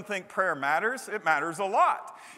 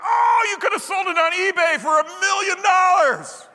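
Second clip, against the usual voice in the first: much higher, louder mock indignant exclaim; 11 semitones higher, 9 dB louder than usual. Listener protests the toy could be sold, mock loud exclaim. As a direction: blurt it like a shocked listener. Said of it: "You're like".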